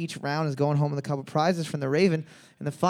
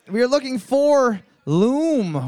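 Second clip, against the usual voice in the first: big announcement; 7 semitones higher, 5 dB louder, slower than usual. Shown as a demonstration. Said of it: "announcing the answer".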